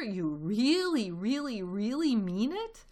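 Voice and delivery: high, drawn-out disbelief; astonished cry. A narrator's reading. Badger can't believe Fox's invitation, crying out in wonder. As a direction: stretch each "really" in hopeful amazement. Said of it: "cried Badger".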